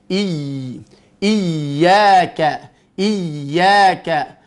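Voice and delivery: IYYAKA with the first Y leaned on, then sustained; pressed ya. Shown as a demonstration. Teacher showing tongue pressure, stressed doubled Y. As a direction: press into the YY of IYYAKA before releasing. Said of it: "Tekan dulu".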